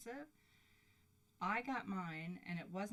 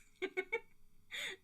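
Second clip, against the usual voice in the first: high giddy burst of laughter. Real, not acted. Giddy laugh after question.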